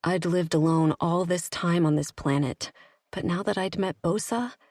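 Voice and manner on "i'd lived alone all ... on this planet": usual speaking voice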